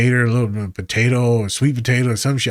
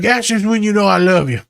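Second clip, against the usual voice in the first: playful client quote; 10 semitones higher, 5 dB louder than usual. Retold or imitated. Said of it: "She's like".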